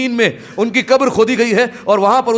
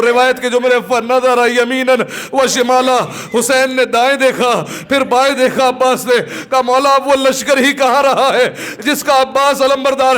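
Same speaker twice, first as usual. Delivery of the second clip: raised, sustained mourning recitation; impassioned lament preaching; 4 semitones higher than usual. Acted. Preacher tells mourners of Abbas the standard-bearer, impassioned lament. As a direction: stir the mourners to wail aloud.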